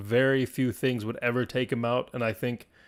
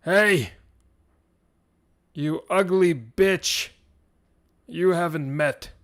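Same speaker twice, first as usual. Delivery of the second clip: loud, higher warrior's taunt with long pauses; yelled challenge; 4 semitones higher, 5 dB louder, slower than usual. Acted. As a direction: yell it across the battlefield. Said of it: "he yells over to Calcian".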